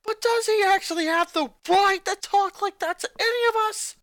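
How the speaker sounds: high, loud indignant tomboy; jock retorting. A narrator's reading. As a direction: snap back, outraged at him. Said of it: "the jock retorted".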